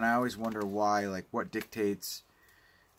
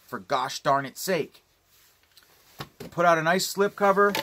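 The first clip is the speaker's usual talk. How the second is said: higher, louder, scolding the label; exasperated rant; 6 semitones higher, 3 dB louder than usual. Real, not acted. Vlogger scolding Kino over missing cover artwork, exasperated. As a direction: call the company out, fed up.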